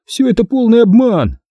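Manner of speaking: high, cross exclamation; indignant outburst. A narrator's reading. Cross boy denounces the book. (возмущённый возглас)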